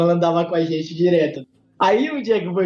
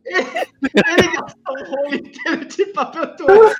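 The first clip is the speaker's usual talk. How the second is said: laughing; 8 semitones higher, 3 dB louder than usual. Real, not acted. Laughing recounting.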